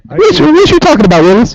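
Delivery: high, fast catchphrase voice; Gary Coleman impression. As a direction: fire off the catchphrase high and quick. Quoting the TV catchphrase.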